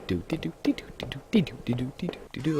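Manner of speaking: tiny mimicked pitter-patter; playful walk noises.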